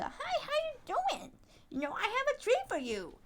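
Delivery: very high, sweet talk to a dog; dog-coaxing voice. Shown as a demonstration. Offering a friendly stray a treat, high coaxing voice. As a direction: demonstrate luring a dog in with high baby talk.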